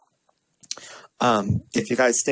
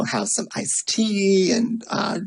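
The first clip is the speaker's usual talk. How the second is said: ordinary delivery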